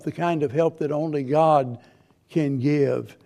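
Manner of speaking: ordinary talk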